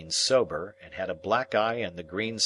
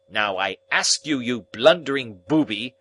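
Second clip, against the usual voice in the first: louder, clipped stern rebuke; 4 semitones higher, 5 dB louder than usual. Acted. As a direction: warn him sternly. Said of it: "said my guardian very sternly".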